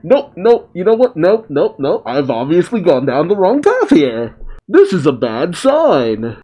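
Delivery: high, hammed-up voice of a villain catching himself; mock realization. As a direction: play Kawaki suddenly seeing he's on the wrong path. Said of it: "the fact that Momoshiki is helping you is like".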